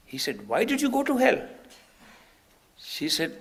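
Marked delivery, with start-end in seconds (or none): 0.5-1.4 high, loud incredulous demand; retold reproach, pitched right up; acted reproachful question. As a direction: demand an explanation from a wayward disciple. Master challenges her in retelling.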